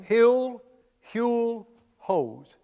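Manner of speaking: "Hill, Huel, hose" as three separate vowel try-outs; comparing pronunciations. Shown as a demonstration. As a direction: step down through "Hill, Huel, hose", first two loud and high. Trying out rival name pronunciations.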